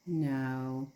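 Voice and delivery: ordinary talk, nothing marked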